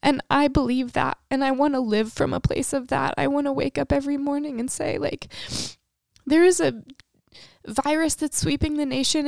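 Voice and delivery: high-pitched, emotional conviction; real emotional. Real, not acted. Praying to the Lord about a hurting nation, emotional. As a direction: declare faith while still overcome, voice lifted. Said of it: "I'm emotional".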